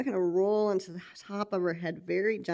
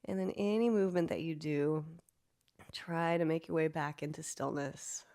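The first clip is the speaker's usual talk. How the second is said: guided meditation; 6 dB quieter than usual. Acted. Calm guided voice continues.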